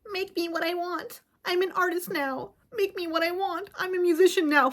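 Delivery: lightly voiced mock AI fan; mocking AI user. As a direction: sneer like an entitled prompter.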